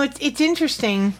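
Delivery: usual speaking voice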